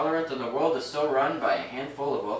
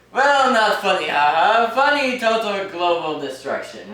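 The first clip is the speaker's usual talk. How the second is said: sarcastic; 4 semitones higher, 6 dB louder, slower than usual. Acted. Sarcastic aside on bombs.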